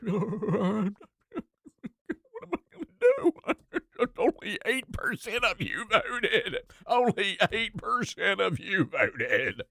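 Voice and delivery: high, slow whiny mock voice with a laugh breaking through; mocking Cornyn. Radio host mocking a senator's complaint, high and slow whine. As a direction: whine it like a sore loser, laughing at him. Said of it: "what was the line from John Cornyn".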